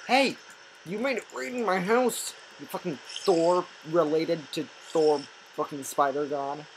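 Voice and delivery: real angry outburst; angry yell. Real, not acted. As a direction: yell at the spider that flooded the house. Yelling at spider in game.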